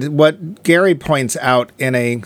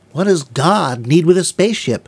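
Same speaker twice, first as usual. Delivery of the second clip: Captain Kirk impression; 2 semitones higher than usual. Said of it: "all I could think of was".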